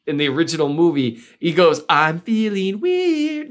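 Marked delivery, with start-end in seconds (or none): 1.9-3.5 high, slow autotune-style singing voice; [name] voice. Retold or imitated. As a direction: drag it out like autotuned rap. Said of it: "with the [name] type voice".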